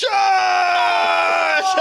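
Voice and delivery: battle cry. Acted. Narrator joins the charge.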